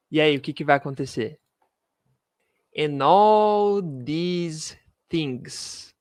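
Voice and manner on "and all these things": very slow, stretched phrase; slow teacher demo; slow model reading. Shown as a demonstration. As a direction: drag it out so students hear each word. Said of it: "teacher models slowly".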